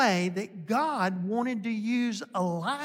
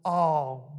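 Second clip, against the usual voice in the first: normal talking voice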